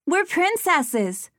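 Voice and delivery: put-on high, slow, gushing child; a small girl beaming with delight; little girl voice. A narrator's reading.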